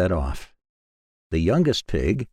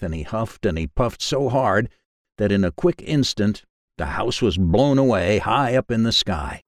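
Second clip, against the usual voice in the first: ordinary delivery, nothing marked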